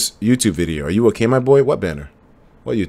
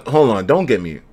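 ordinary delivery

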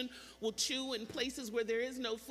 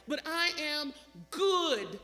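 slow build to a loud, long "good"; "good" drawn out and pushed louder; stress on GOOD; 3 semitones higher, 5 dB louder, slower than usual. Shown as a demonstration. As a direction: land heavily on "good". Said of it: "Emphasis on the word good".

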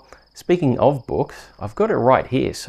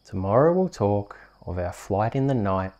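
bedtime story reading. Acted. Host reading a bedtime rhyme to a sleepy child. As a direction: lull a little one to sleep.

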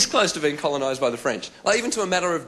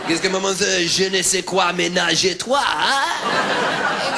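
high gibberish French ending in a questioning lift; exaggerated French imitation; mock French noises; 5 semitones higher, 4 dB louder, much slower than usual. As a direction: babble fake French with stock phrases dropped in. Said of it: "I make French noises and then drop in".

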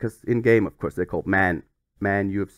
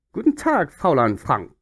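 mock German; 4 dB louder than usual. Reading Wolfsburg fax aloud.

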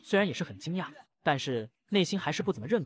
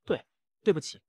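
ordinary delivery

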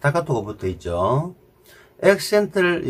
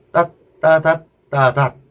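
따, 따다, 따닷 each syllable cut very short; staccato; 2 semitones higher, 3 dB louder than usual. Shown as a demonstration. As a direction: clip every note short, staccato. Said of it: "스타카토로 처리할 때 어떻게 하냐".

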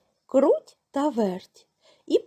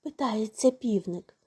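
plain reading voice

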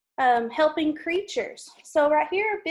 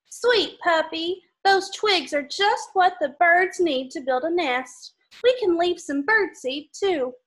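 lively storybook read-aloud; reading to children; 3 semitones higher, 4 dB louder than usual. Acted. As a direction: read it brightly for little kids. Reading picture book to kids.

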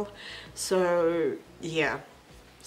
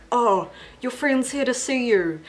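higher-pitched imitation of a mom; lightly acted mom voice, higher; mother calling; 6 semitones higher, 3 dB louder than usual. Quoting Hazel's mother in the movie.

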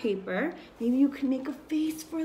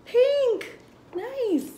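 excited; 11 semitones higher, 5 dB louder than usual. Acted. Teacher praising color for kids.

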